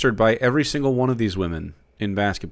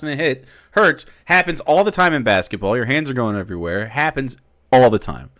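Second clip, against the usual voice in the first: ordinary talk, nothing marked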